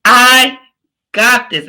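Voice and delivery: loud, held I, then a beat before "got this"; stress on I. Shown as a demonstration. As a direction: land hard on "I", pause, then "got this". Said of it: "So I want you to say".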